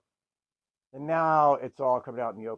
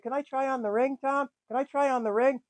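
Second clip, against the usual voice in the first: higher, lightly voiced sweet kid; little girl voice; 11 semitones higher than usual. Little sister greeting Tom, higher kid voice. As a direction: put on a chirpy kid voice.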